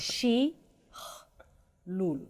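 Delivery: Shi, a throaty cha, then lul, each apart; slow teaching demo of Shi-ch-lul; sound by sound. Shown as a demonstration. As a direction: break Shichlul into separate sounds with long pauses. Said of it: "Now you have the cha sound".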